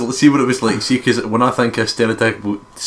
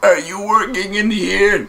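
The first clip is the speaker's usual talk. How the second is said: high, slow imitation of a friend's question; imitating her; 10 semitones higher, slower than usual. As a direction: mimic her asking sweetly. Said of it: "and then she was like".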